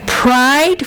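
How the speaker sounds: ordinary delivery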